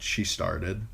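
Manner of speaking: plain reading voice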